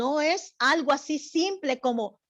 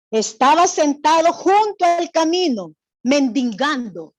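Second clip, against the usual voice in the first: emphatic scripture reading; 3 semitones higher, 8 dB louder than usual. Acted. Teacher rereading the verse to the class, loud and emphatic. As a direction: proclaim the verse so every child hears.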